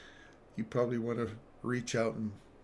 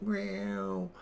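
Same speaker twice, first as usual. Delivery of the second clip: high meow imitation; pitched-up spoken meow; cat meow; 10 semitones higher than usual. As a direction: mew like a stuck cat. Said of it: "and it's like".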